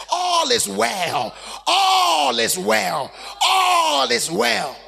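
slow, loud, building cry; slow triumphant shout, nearly crying. Acted. Preacher shouts all is well.

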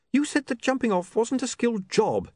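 put-on high, strained young man's voice; anxious questioner. A narrator's reading. William questions Arthur on the ledge, high and anxious. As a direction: question him while trying to stay steady.

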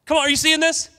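loud, high, fervent preaching; full-force preaching. Acted. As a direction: preach it out with fire to the church.

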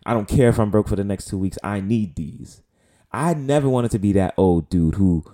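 usual speaking voice

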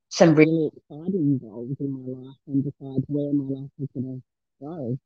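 muffled, churning mic sound; like talking from inside a washer; washing machine sound. Real, not acted. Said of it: "you sound like you're in a washing machine".

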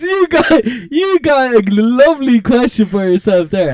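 hammed-up proud father; mock proud dad. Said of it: "he goes".

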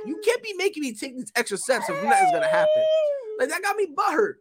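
mock outrage at extra steps; whiny high complaint. To the guy who left, a whiny complaint.

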